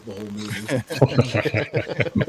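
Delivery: words breaking into a laugh; amused. Real, not acted. Panelist jokes about an actor playing himself, laughing. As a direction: finish the jab laughing, drawn out.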